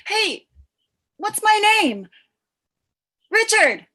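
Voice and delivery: excited. Acted. Confused loud guessing.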